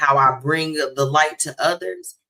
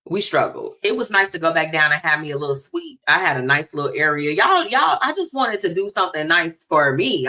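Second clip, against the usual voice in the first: ordinary delivery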